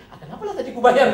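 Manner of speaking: mock your own second thoughts; laughing inner grumble. Preacher voicing his regret at paying, laughing high grumble. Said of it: "dalam hati".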